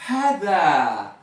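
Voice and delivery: clear, drawn-out hada; Arabic word. Shown as a demonstration. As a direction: say hada slowly with a breathy h. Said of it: "Par exemple".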